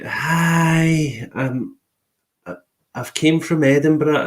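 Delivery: put-on half-hearted American tourist accent. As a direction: imitate the American lady in a lightly American voice. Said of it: "I'm not even gonna try and do her accent".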